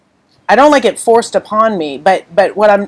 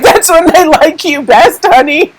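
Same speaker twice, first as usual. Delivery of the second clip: higher-pitched quote breaking into laughter; laughing retold reassurance; 7 semitones higher than usual. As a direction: quote him fondly, then crack up laughing. Quoting husband, breaks into laughter.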